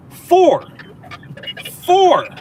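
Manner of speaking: high, loud mock answer shouted out. Streamer mocks chat for stating the obvious, shouting. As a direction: yell it like a proud know-it-all.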